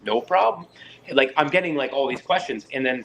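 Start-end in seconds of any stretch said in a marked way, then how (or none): 0.0-0.6 mock officer request.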